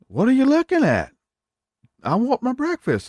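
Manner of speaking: hammed-up squeaky grandpa; grumpy grandpa. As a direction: play a cranky grandpa demanding food. Dead grandpa returns, grumpy.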